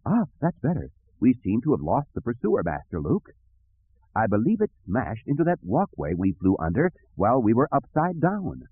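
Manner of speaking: prim droid with high, lilting peaks; relieved droid. Acted. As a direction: report fussily once danger has passed. Droid reports after the scare.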